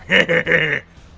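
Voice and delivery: loud guttural orc chuckle; short, loud orc snicker; orc laugh. Shown as a demonstration. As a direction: rattle out a throaty orc cackle. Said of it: "laugh right at the end of everything".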